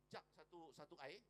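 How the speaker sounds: ordinary delivery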